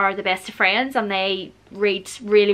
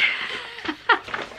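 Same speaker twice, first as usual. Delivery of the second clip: a high, delighted burst of laughter; high surprised laugh; 12 semitones higher, 6 dB quieter than usual. Real, not acted. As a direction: laugh out in happy disbelief. Laughs at gift wrapping.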